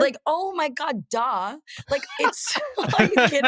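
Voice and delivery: put-on high, laughing valley girl disbelief; scoff, laughing, way up high; incredulous.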